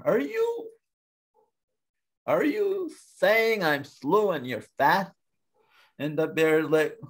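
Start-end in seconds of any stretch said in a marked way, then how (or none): 0.0-5.1 incredulous turtle. Acted. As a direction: stretch the question high in disbelief. Turtle feigns disbelief at insult.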